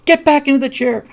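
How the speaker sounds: high, loud imitated yell; screaming parents. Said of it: "they start screaming".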